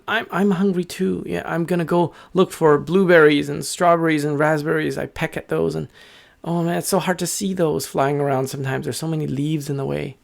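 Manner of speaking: high, chatty bird voice; hungry little bird. Acted. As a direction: complain lightly as a hungry small bird.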